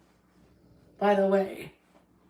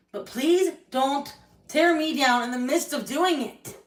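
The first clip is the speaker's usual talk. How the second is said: raised, louder pleading voice; angry plea; 6 semitones higher, 5 dB louder than usual. Real, not acted. To Corey, begging not to be torn down, raised angry plea. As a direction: beg him hard, voice climbing.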